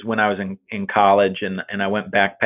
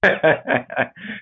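amused laugh; 7 semitones higher than usual. Real, not acted.

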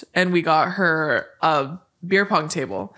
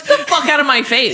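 mock angry dismissal; 7 semitones higher, 5 dB louder than usual. Mock telling off the referee.

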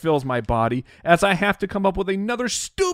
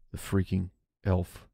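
hushed, much lower and softer; whispering; 12 semitones lower, 8 dB quieter than usual. Real, not acted. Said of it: "I have to whisper".

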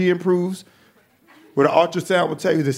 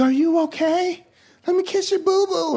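put-on much higher sweet baby talk; cooing parent; 11 semitones higher than usual. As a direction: coo like a fussing parent. Parents comforting hurt kid.

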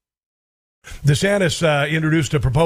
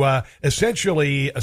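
ordinary talk

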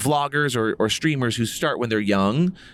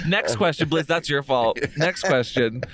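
ordinary talk, nothing marked

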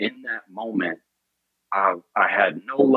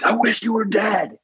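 higher-pitched mock yell at the coach; retold yell; 12 semitones higher than usual. Retelling a fight with his coach, a higher mock yell. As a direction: fling the words up high. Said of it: "I yelled at him".

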